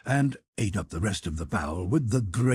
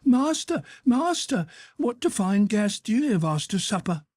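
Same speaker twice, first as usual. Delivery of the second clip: mock screaming; 12 semitones higher than usual. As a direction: scream for the master in pretend outrage. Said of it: "ran screaming".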